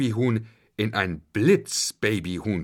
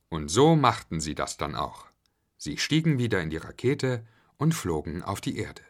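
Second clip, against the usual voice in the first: plain reading voice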